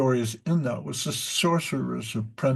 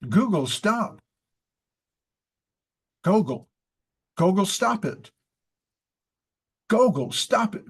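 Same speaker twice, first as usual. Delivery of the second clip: imitating a little girl; 7 semitones higher, 4 dB louder than usual. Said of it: "she tried to imitate me".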